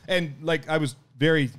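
normal talking voice

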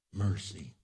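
normal talking voice

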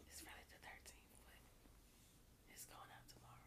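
soft unvoiced whisper. Real, not acted. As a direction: whisper it like ASMR. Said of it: "Why are you whispering?".

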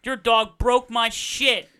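loud, slow and heavy, every word stomped; a hammed-up furious customer, dragged out; angry slow demand. Cranky neighbor slamming a snowman head down, loud and slow. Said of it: "she's like".

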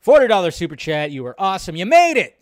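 thrilled a regular showed up; excited greeting. Real, not acted. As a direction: welcome him in with a burst. Greeting a donor in chat.

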